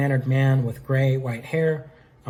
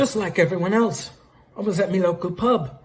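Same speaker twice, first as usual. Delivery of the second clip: higher-pitched, shaky attempt at Australian; hammed-up Australian pub regular; bad Australian accent; 6 semitones higher than usual. Lord Raptor answering Phoenix Wright, badly faked Australian accent. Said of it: "do Australian".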